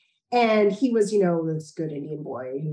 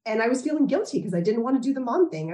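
usual speaking voice

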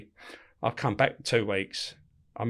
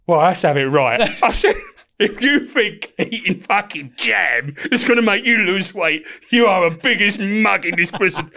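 laughing; 6 semitones higher, 6 dB louder, slower than usual. Retold or imitated. Telling prisoner off while laughing.

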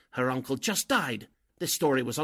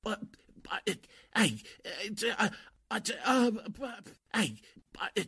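high, broken stammering panic; sputtering helplessly; flustered stammer; 9 semitones higher than usual. Acted. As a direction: stumble over every word, cornered. Con man's trick exposed.

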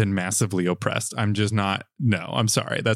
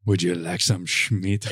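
slow, hammed-up French accent; 3 semitones lower, slower than usual. Tall man offering himself in the story, slow French accent. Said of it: "You gonna do a French accent?".

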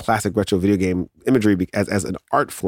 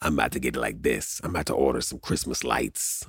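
low, soft, deadpan buying voice; online-shopping voice; 8 semitones lower, 8 dB quieter than usual. Shown as a demonstration. Paying eighteen dollars right away, low soft deadpan voice. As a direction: murmur it low like clicking buy now. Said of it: "I sound just like that".

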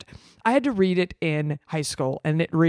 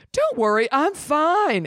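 sassy; 10 semitones higher than usual. Acted. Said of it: "make Ellie a little bit more sassy".

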